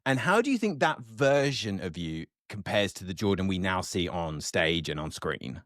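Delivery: ordinary talk, nothing marked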